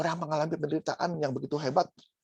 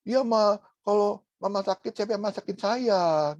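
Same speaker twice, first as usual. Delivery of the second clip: put-on child voice; 7 semitones higher, slower than usual. Preacher voices the child worried about food, mock whine. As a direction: drag it out like a selfish little child.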